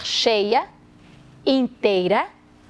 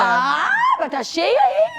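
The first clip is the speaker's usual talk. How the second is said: high, drawn-out teasing cry; mock exclamation; 11 semitones higher, slower than usual. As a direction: exaggerate the mock astonishment, slowly. Mocking claims of being full. (deboche exclamado, agudo e arrastado)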